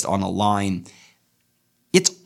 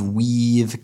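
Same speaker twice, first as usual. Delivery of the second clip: ordinary delivery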